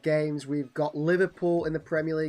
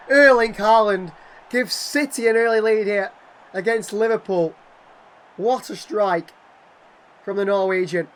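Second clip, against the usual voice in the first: excited commentary; 5 semitones higher, 10 dB louder than usual. Real, not acted. Gamer telling viewers City lead, loud and thrilled. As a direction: still high and loud, riding the goal. Goal call continues.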